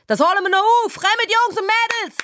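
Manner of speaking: very high, urgent team cheer; excited captain's rallying cry; rallying shout. A narrator's reading. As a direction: holler encouragement across the pitch.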